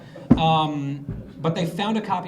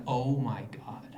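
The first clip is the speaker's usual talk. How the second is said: breathy whispered oh my God; whispered shock; 3 semitones lower, slower than usual. Retold or imitated. Boston press reacting in a shocked whisper. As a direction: gasp it under your breath. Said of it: "the Boston press was like".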